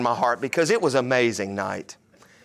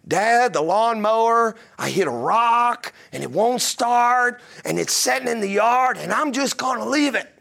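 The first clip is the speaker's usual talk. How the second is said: imitating his son; 7 semitones higher than usual. Pastor voices son on phone.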